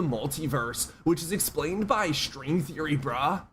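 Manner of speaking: stoner guru voice. Retold or imitated. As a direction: preach cosmic wisdom like a stoned bro. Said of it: "I used to talk like that".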